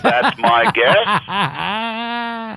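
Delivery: high, laughing reply. Real, not acted. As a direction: laugh through the words. Laughing, high pitched answer.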